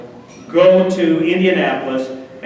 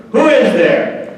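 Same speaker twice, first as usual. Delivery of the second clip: slow, loud, higher booming call through the door; big booming official; 6 semitones higher, 5 dB louder, slower than usual. Retold or imitated. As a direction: boom it like a big referee. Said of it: "a big official with a big voice".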